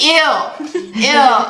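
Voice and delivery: high, squealing "ew" with a laugh; disgusted squeal through laughter; grossed out. Retold or imitated. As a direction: recoil at the mess, half laughing. Grossed out by drawers.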